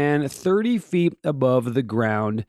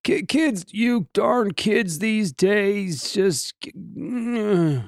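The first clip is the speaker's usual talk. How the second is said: put-on cranky old man complaining slowly; 6 semitones higher, slower than usual. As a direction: grumble like a crotchety grandpa. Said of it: "Sound like an old man, don't I?".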